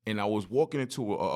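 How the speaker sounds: usual speaking voice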